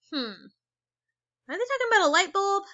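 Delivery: hammed-up wondering question; mock puzzled. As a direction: play dumb for the kids. Teacher feigns confusion for kids.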